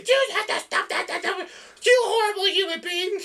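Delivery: high squeaky scolding voice; hammed-up outraged scold; mock scolding. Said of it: "I'm not gonna sit here and say".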